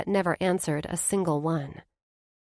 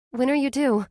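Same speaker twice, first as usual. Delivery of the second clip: high, reassuring friend's voice; best friend, kind and sure; comforting Blair; 6 semitones higher, 5 dB louder than usual. A narrator's reading. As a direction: ease her friend's guilt.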